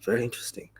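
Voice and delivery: quick, soft mumble under the breath; mumbling. Retold or imitated. Ajahn Chah mumbling over the man's palm, quick and soft. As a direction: murmur it half to yourself, quickly. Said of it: "and mumbling".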